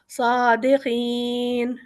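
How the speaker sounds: صادقین recited slowly, with the final nun held long; Quranic pause recitation. Shown as a demonstration. Teacher recites صادقین with a long stop at the end. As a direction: stretch صادقین as if ending an ayah. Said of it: "نون زبر کو ہم نے وقف کر دیا".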